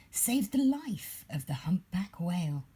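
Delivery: ordinary delivery, nothing marked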